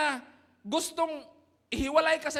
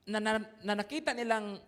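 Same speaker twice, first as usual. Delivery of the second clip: ordinary talk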